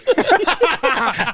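loud high-pitched cackling laugh; high cackle. Real, not acted. Cracking up at his own story with friends, high cackle.

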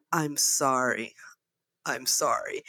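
low, soft, slow imitated apology; mock hangdog sorry, pause between; meek apology. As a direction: mimic a sheepish man apologising. Sound guy repeats meek apology.

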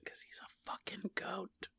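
hushed, fully whispered punchline; whispered aside. Pretend. Co-host slipping in a goat pun, whispered. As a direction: whisper it like a secret joke. Mock secret joke to co-host.

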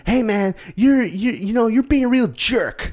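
very high, prissy voice, slowing at the end; mock a squeaky-clean Disney kid; mock goody-goody kid. A fake film kid scolding a friend, hammed-up high prissy. Mocking wholesome film kids.

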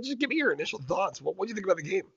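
ordinary delivery

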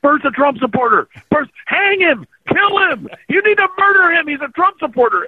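high-pitched hysterical mob yell; mock internet outrage. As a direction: imitate an online mob losing its mind, voice way up high. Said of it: "the internet lost their minds".

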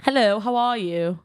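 put-on slow, proper British accent. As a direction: greet them in a British accent. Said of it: "do a British accent?".

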